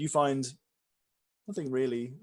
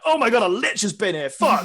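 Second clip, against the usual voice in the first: mock alarmed exclamation; 8 semitones higher, 11 dB louder than usual. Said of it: "it's not something you'd be like".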